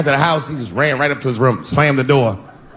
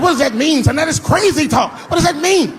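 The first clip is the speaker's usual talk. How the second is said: high, exasperated disbelief; a father who can't believe it; incredulous dad. Retold or imitated. Dad questioning son in the office, high and incredulous.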